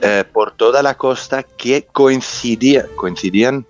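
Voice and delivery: Spanish with a light American accent. Real, not acted. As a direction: native English speaker's Spanish. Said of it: "mi español es un poco soso".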